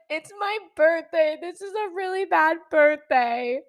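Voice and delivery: high whiny mock wail; hysterical crying. Crash victim lamenting to bystander, hysterical crying. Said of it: "I'm just hysterically crying".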